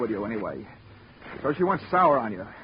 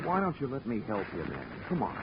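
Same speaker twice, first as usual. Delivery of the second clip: much softer, low and calming; gentle coaxing; 3 semitones lower, 10 dB quieter than usual. Acted. Detective quietly coaxes agitated man to accept help. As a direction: ease him down quietly.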